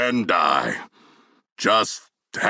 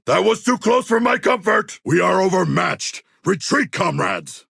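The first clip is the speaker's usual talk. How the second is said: higher, urgent cry to fall back; alarmed shout; 8 semitones higher than usual. Acted. King Shark calling his comrades to retreat, alarmed shout.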